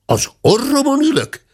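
high, baffled question; astonished. A narrator's reading. Hörpentő mishearing the servant, astonished and high. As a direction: ask it high in disbelief.

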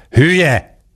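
magas, dühös felkiáltás: „Hülye!”; kiabálás. A narrator's reading. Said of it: "kiabálta Török Ádám". (dühös kiáltás)

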